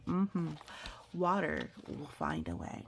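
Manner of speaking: ordinary delivery, nothing marked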